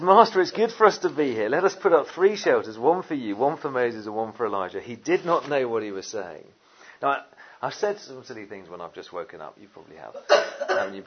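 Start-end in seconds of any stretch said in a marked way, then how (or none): 0.0-4.8 Peter's eager words. Acted. Peter blurting again, louder and more excited. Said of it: "Peter says".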